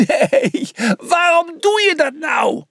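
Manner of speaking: high, exasperated yell; dismayed cry. A narrator's reading. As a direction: wail it out in disbelief. Horrified reaction to the launch.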